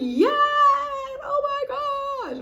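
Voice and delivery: high, loud crying shout of joy; overjoyed shout. Retold or imitated. Hearing congratulations, she shouts high with joy. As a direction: scream it with happy tears. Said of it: "I'm like".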